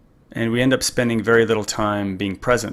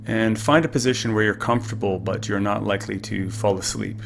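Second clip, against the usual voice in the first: calm; 4 dB quieter than usual. Acted. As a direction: gently guide the listener into stillness. Leads a guided breathing meditation.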